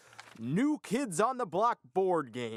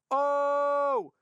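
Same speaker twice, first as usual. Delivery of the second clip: excited outburst; 12 semitones higher, 6 dB louder than usual. Acted. Sudden outburst.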